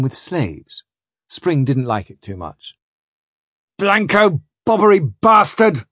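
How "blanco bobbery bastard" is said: raised, high cursing; irate captain. Acted. Spring cursing Blanco to the crew, irate captain. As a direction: curse the absent trader in coast pidgin. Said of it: "says Spring".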